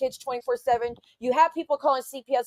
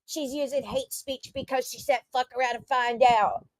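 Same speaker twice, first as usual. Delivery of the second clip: mocking the haters; 4 dB quieter than usual. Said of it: "And you're like".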